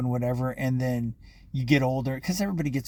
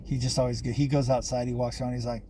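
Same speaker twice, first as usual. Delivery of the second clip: usual speaking voice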